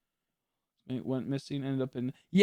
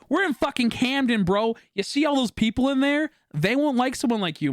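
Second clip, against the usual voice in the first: higher, pressing warning to mom; urgent warning to mom; 10 semitones higher than usual. Retold or imitated. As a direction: urge her to stay in the car. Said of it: "I'm like".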